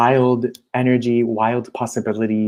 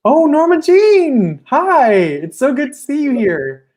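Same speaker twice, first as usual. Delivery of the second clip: high, loud, drawn-out delighted squeal of a name; excited greeting; 8 dB louder, slower than usual. Real, not acted. Host greeting a guest she knows from Instagram, thrilled high loud welcome. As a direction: greet her like a long-lost friend, much higher and louder.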